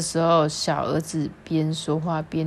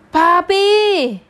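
high, loud excited call; calling dad; 12 semitones higher, 8 dB louder than usual. Acted. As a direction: shout for dad from the door. Son runs home calling dad.

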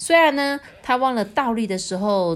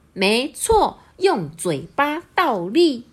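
higher, slow, deliberate nod; confirming crow; 5 semitones higher, 3 dB louder, much slower than usual. A narrator's reading. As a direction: spell it out to the peacock. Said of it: "小乌鸦就点点头说". (慢慢地肯定)

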